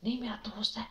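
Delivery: soft unvoiced whisper, pleading; whispered child's excuse. Child whispering to mother to meet a friend, whispered plea. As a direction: hush it like a child sneaking past mum. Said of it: "تو کہتے ہیں".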